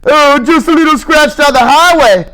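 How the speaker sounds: high sing-song mock of the bus driver; lightly hammed-up driver voice. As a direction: ape the driver's breezy tone. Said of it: "he says".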